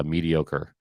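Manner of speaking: ordinary delivery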